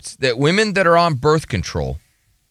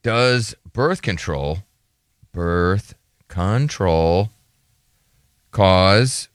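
very slow, low, word by word; drawn-out low voice with long gaps; slow piecemeal reading; 9 semitones lower, much slower than usual. Real, not acted. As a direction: sound out a query as if typing it. Slowly voicing a search question being entered.

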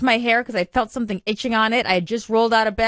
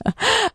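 high laugh; 14 semitones higher than usual. Real, not acted. Laughs at cohost's joke.

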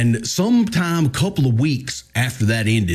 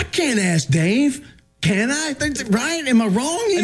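high-pitched mock pleading, appealing for backup; pleading protest; 10 semitones higher, slower than usual. Husband asking his co-host if he's wrong, pleading. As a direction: protest slowly, then climb high into questions.

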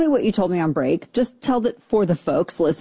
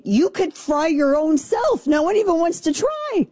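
exasperated outburst; 8 semitones higher than usual. Real, not acted. Host answering people who ask about frying eggs, exasperated. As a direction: let the rant climb into a squeal. Comic rant about extreme heat.

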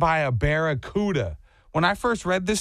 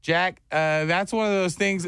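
normal talking voice

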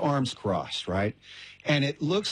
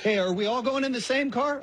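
high, quick, lightly acted question; eager asker; 11 semitones higher than usual. Family member asking stepdad about dinner, eager. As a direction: ask brightly up high, a bit rushed.

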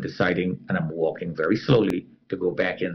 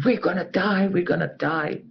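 higher retold panic; mock panicked scream; 8 semitones higher than usual. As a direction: imitate the crowd's terror, not full volume. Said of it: "Everybody is screaming".

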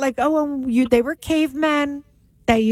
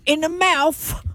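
slow, higher-pitched hammed-up British; mock British accent; 5 semitones higher than usual. Comic jokes about British teeth, mock British accent.

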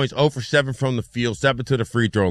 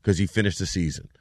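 low, soft deadpan retort; understated sarcasm, lower and softer; sarcastic apology; 6 semitones lower, 7 dB quieter, faster than usual. Retold or imitated. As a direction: drop down into a dry mock apology. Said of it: "And I was like".

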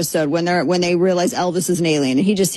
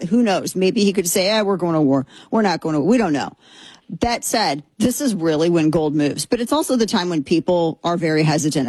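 ad read; 5 semitones lower than usual. Acted. Reads a sponsor ad.

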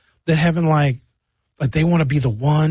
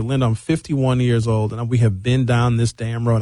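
normal talking voice

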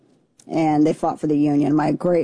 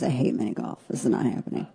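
hushed, mostly whispered private thought; muttered to herself, dreading a date at mini golf; inner thought; 6 semitones lower, 7 dB quieter, faster than usual. Retold or imitated. A hushed inner groan at a mini golf invite. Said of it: "my first thought was".

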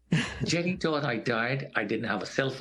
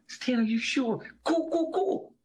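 put-on much higher, worried young woman; young lady's voice; 13 semitones higher than usual. Coworker turning back to Stan as she leaves, high and anxious. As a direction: voice her nervous and urging.

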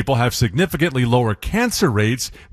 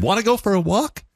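higher happy sing-song question; dog baby talk; 8 semitones higher than usual. Shown as a demonstration. Host demoing dog-directed talk, higher happy sing-song. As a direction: ask the dog like it's the best news ever. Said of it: "in a sing-songy tone with happy inflections and a higher pitch".

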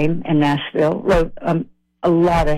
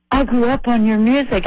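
higher-pitched mock Indian accent; bad Indian accent; 6 semitones higher than usual. Clerk from India telling the singer he's a fan, mock Indian accent. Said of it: "That's my bad Indian accent".

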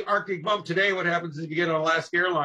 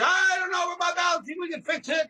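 mock-innocent pilot; 14 semitones higher than usual. Archie teasing a scared passenger, high mock-innocent. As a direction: play dumb in a high put-on voice. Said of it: "And Archie would say".

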